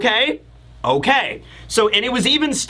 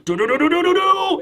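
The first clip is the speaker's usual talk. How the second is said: rapid rising "do-do-do" in a high pitch; dramatic zoom sound effect; 8 semitones higher, faster than usual. Shown as a demonstration. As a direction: vocalize a cartoon zoom-in, high and building.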